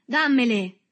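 high, loud demand; furious shout. A narrator's reading. Berto lunges for his cigarettes, shouting furiously. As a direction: yell it while lunging at him. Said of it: "gridò Berto avventandosi furioso".

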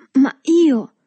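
loud, high exasperated protest; insist, exasperated at being betrayed; esasperato. A narrator's reading. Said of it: "esasperato dal tradimento del Tortima". (protesta esasperata, forte e acuta)

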